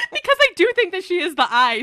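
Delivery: talking through real laughter, high and loud; can't hold back the laugh while speaking. Real, not acted.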